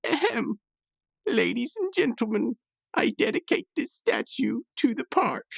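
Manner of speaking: high, lightly put-on gentleman's voice; Pops voice. Recapping Pops dedicating the statue, high put-on voice. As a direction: announce it like a proud old gentleman.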